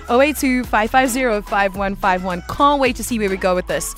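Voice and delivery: ordinary talk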